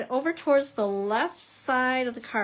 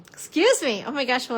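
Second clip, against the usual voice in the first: ordinary delivery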